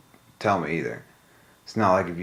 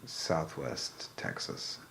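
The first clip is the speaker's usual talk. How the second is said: mutter; 6 semitones lower, 14 dB quieter, much slower than usual. Pretend. Muttered joke region name.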